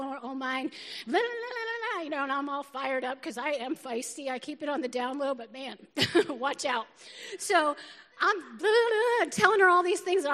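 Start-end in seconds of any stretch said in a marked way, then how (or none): 1.1-2.0 high-pitched "la la la" chatter; imitated venting in high la-las; high rambling la-la-la. Mimics her own rapid venting. 8.4-9.3 high, loud "blah, blah, blah"; slow, raised-pitch blah-blahing; mock ranting blahs. Mimics her flustered ranting.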